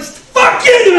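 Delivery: high, loud cursing yell; angry shout. Retold or imitated. Driver cursing at another car, high furious yell.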